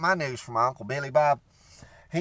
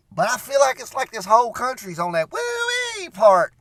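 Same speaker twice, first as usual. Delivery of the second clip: riled-up shout; 8 semitones higher, 6 dB louder than usual. Real, not acted. Riled-up rant about the country.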